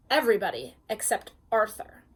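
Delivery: usual speaking voice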